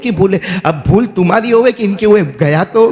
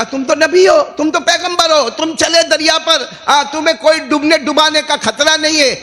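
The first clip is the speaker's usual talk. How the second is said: high, raised acted plea; insistent appeal; 10 semitones higher than usual. Passenger appealing to prophet who walks on water, insistent. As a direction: press your case in a high preaching voice.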